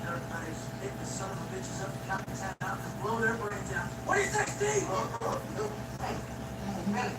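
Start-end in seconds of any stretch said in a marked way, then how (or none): 4.1-5.6 mocking high voice. Mocking high-pitched jeer at Steve.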